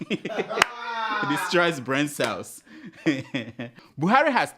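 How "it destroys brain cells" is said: very slow, laughing through the words. Acted. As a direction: drag it out while cracking up. Laughing slow repeat of punchline.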